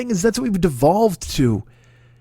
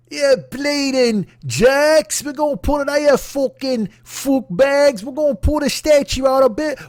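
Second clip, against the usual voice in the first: high, loud, dragged-out mock outrage; mock angry patriot; 8 semitones higher, 4 dB louder than usual. Mocking the statue savers in a hammed-up outraged voice. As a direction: ham up an indignant statue defender. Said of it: "But they do".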